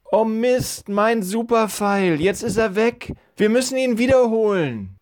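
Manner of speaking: put-on high, dismayed boy's voice; a kid wailing over his lost arrow; upset boy. Joel loses his arrow.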